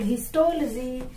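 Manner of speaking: histology as his-TOL-jee; corrected pronunciation. Shown as a demonstration. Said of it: "it is called histology". (histology को हिस्टोल-जी बोलकर)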